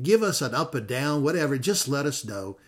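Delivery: ordinary talk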